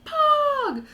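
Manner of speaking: excited "Pog". Retold or imitated. Telling friends her reaction to the found table, a high thrilled yelp. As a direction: squeal it like great news. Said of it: "And I'm like".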